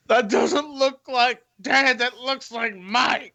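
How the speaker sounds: put-on slow, high drunken squinting voice; imitated drunk. Co-host mimics a drunk with bad vision picking fights, slow drunk voice. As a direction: drag it out like a muddled drunk. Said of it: "He probably was really drunk".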